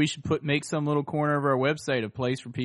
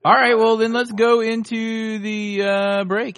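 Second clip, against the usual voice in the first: announcer voice; 7 semitones higher, 7 dB louder, slower than usual. Acted. Announcing the show break.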